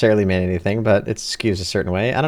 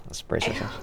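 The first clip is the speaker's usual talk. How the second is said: whispering; 5 semitones lower, 14 dB quieter than usual. Real, not acted. Bracing for a cringe answer.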